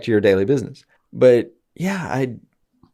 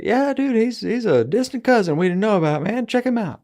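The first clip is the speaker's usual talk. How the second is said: mock family introduction; 11 semitones higher than usual. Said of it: "bring Michael Rooker to a family reunion and be like".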